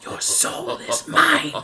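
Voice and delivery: slow, breathy villain whisper with echo; menacing sorcerer hiss, drawn out; Shang Tsung. Acted. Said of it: "That was Shang Tsung".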